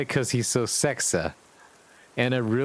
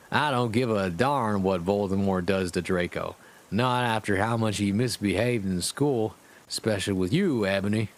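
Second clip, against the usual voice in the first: usual speaking voice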